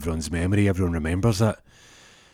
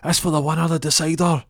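referee urging; 8 semitones higher, 3 dB louder than usual. Retold or imitated. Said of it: "referee's like".

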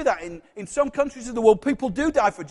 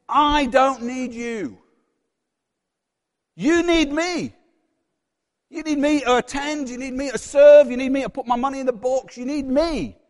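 self-important declaration; 3 semitones higher, 4 dB louder than usual. Acted. Prayerless believer telling God he is needed, self-important.

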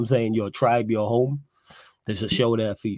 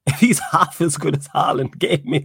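louder, laughing emphasis; emphatic repeat; 5 semitones higher, 5 dB louder than usual. Real, not acted. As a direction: hammer the punchline home with a laugh. Repeats joke line louder, laughing.

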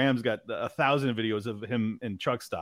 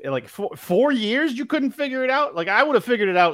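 high-pitched, incredulous outburst; exasperated disbelief; 10 semitones higher, 3 dB louder than usual. Acted. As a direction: argue it back, voice climbing in disbelief. Mock exasperated retort to Trump.

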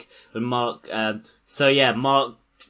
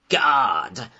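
mock Boston accent on God; 3 semitones higher, 5 dB louder than usual. Preaching God to convicts in a put-on Boston accent. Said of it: "how you say God in a Boston accent".

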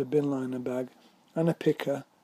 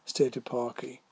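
plain reading voice

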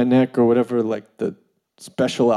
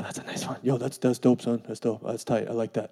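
low, hushed admiring mumble; quiet mock admiration between writers; fangirling writers; 3 semitones lower, 8 dB quieter than usual. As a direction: murmur little compliments under the breath. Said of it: "they're fangirls with each other".

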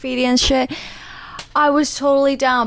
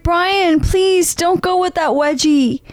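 higher, drawn-out mock plea; pleading; 6 semitones higher, 3 dB louder, slower than usual. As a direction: beg him, stretching the words. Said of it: "I was pleading with Brian".

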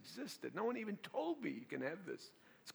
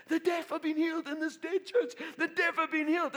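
loud, high exultant announcement; ecstatic proclamation; 12 semitones higher, 8 dB louder than usual. Retold or imitated. Pastor to his dead church after a deaf man hears, exultant shout. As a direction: proclaim the miracle to the room.

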